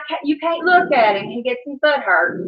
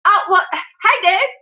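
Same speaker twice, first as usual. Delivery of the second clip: normal talking voice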